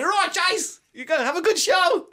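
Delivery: high-pitched rallying shout-out, retold; mock pep call to the guys; mimicked hearty call. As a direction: imitate someone calling encouragement to the performer. Mimics crew calling out backstage.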